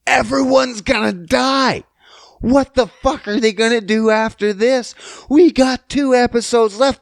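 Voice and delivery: high, frantic excited outburst; losing it. Retold or imitated. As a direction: exclaim like a viewer freaking out. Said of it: "I'm losing my shit the entire time going".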